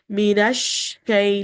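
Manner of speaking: Minash shay slowly with a wide spreading sh; spread sheen. Shown as a demonstration. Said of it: "let that voice spread".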